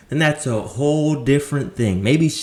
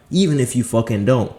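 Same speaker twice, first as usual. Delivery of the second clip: ordinary talk, nothing marked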